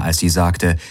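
ordinary delivery, nothing marked

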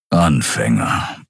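low, slow grumble of a big beast; deep, slow dismissive rumble; grumbled. A narrator's reading. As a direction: grumble it low, drawing the word out. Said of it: "brummte Keru".

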